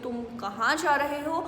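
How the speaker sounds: normal talking voice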